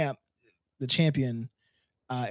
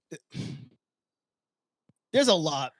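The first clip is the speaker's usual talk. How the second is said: usual speaking voice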